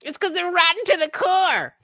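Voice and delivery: high, mocking parroting voice; mocking impression. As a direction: parrot her words back with scorn. Mocking the other speaker's claim.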